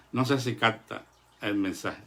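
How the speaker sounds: ordinary delivery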